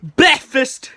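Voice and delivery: loud, high shout; short explosive high yell. Acted. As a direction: shout it like a whole crowd. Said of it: "everyone shouts".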